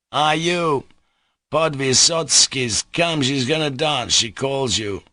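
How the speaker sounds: put-on a drunk swaggering at a door; drunken Mitya. Mitya drunkenly calling the locked-up Poles out.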